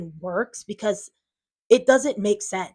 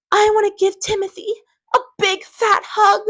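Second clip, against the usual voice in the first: much higher and louder, syrupy overdone sweetness; mock gushing sympathy; 13 semitones higher, 7 dB louder than usual. Mocking critics who want gushy sympathy, high sugary parody voice. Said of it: "Like, I don't talk like that".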